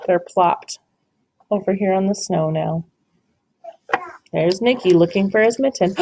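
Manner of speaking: usual speaking voice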